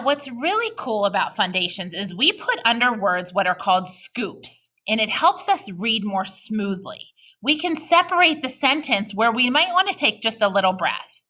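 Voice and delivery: usual speaking voice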